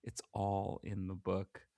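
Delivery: slow, soft, ominous; ominous hush. Retold or imitated. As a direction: drop it low and slow like a warning. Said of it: "he says".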